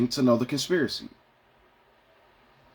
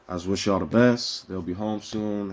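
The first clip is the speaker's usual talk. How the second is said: ordinary delivery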